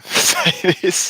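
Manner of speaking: words breaking into a loud laugh. Real, not acted. Streamer surprised by own confession, laughing. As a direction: laugh through the end of the phrase.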